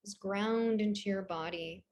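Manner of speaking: soft, low-key breathing guidance; calm meditation voice with long, restful pauses; guided meditation. Real, not acted. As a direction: ease them into stillness, softly and unhurried.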